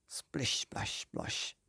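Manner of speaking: hushed whispered splish splash splosh; soft splashing sound effect. A narrator's reading. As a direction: drip the words like water. Birds splash into water.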